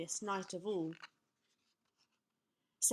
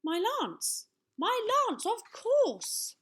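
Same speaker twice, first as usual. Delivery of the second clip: high delight, building louder; smiling realisation; 13 semitones higher, 6 dB louder, slower than usual. A narrator's reading. Young knight finds his plan, smiling and elated. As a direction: repeat it with mounting excitement. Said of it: "smiled and said".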